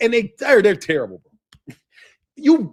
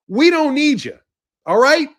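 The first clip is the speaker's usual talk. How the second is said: ordinary delivery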